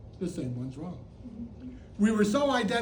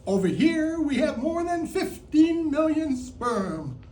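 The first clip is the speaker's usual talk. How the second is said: event announcer; 6 semitones higher, 4 dB louder than usual. Pretend. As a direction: present the competitors to the crowd, pitched up. Plays sports announcer introducing competitors.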